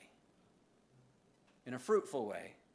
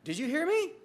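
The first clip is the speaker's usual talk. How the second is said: high, louder mock spouse asking; imitating spouse; 13 semitones higher, 6 dB louder than usual. Preacher imitating a spouse checking if he listened, high and louder. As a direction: pitch way up as the annoyed spouse. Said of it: "and they say".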